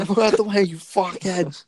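exaggerated mock yell; Joey Diaz. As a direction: stretch it out in a put-on accent. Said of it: "But Joey Diaz is".

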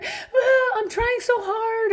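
very high mock wail of self-pity; hammed-up whiny outburst; immature wail. Parent feeling sorry for herself at the kids, immature high wail. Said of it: "any of the immature reactions".

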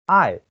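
爱 starting high and falling sharply; fourth tone. Shown as a demonstration. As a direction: say 爱 strongly with a steep drop. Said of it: "四声".

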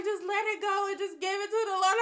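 fast, lightly voiced pastor; quick pastor advice. Pastor urging her to give it to God, fast. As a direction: rattle off the advice briskly. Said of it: "She was like".

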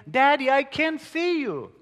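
put-on a frightened boy's high, loud protest; protesting child. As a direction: cry out as a scared little boy. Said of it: "the boy protested".

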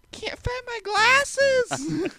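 very high, slow squeaky Velma. Retold or imitated. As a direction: stretch it out in a squeaky cartoon girl voice. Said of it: "I wanna be Velma".

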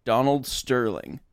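ordinary talk

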